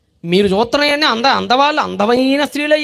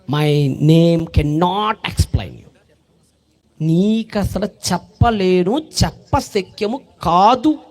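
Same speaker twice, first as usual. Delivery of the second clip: low, very slow, otherworldly angel; solemn angel voice; 6 semitones lower, much slower than usual. Acted. Angel tells Manoah his name cannot be spoken, low and slow. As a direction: speak as a mighty angel.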